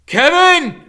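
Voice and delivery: loud, high alarmed yell; shout. A narrator's reading. Grandfather calling for help from the other room, loud alarmed yell. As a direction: call out in sudden alarm. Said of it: "Grim shouts".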